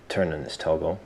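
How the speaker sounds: ordinary talk, nothing marked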